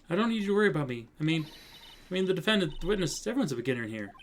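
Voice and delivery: put-on high-pitched, flustered young lawyer; defensive rookie. Rookie lawyer answering her mentor back, defensively.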